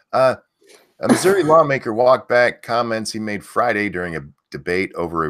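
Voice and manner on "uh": ordinary talk, nothing marked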